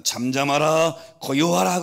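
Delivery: loud, high, drawn-out command; commanding rebuke. Retold or imitated. Jesus ordering wind and sea to be still, commanding rebuke. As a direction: call it out forcefully as a preacher quoting scripture. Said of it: "바람과 바다를 꾸짖습니다".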